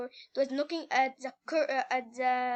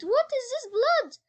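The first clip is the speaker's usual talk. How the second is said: high, loud alarmed shout; frightened cry; 10 semitones higher, 5 dB louder than usual. A narrator's reading. Scared girl finding blood on the floor, frightened cry.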